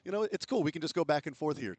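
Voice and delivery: Boston fan voiced. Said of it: "It's like".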